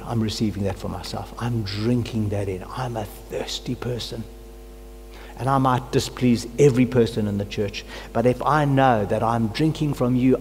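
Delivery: ordinary talk, nothing marked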